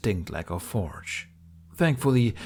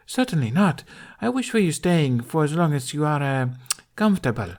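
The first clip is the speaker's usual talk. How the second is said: rushed, poised empress; 6 semitones higher, 3 dB louder than usual. Acted. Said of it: "appearing both rushed and poised".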